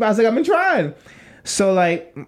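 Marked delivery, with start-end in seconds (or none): 0.3-0.9 high-pitched, helpless protest; mock desperation. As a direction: plead as if at wit's end. Retold desperate answer to friend.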